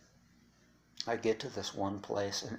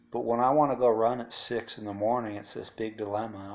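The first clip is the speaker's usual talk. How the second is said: ordinary talk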